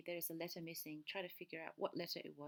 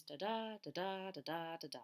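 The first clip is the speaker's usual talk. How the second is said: each "da da" stressed on the second "da"; iambic rhythm. Shown as a demonstration. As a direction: beat out "da da" with weight on every second "da". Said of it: "the emphasis is on the second syllable".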